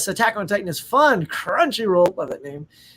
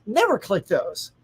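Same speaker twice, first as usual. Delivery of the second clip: ordinary talk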